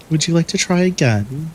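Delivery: put-on high, sugary baby talk to a bully; coddling voice. Mocking a passive-aggressive classmate in a high coddling voice. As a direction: talk down sweetly as if to a toddler. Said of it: "put on this, like, coddling voice".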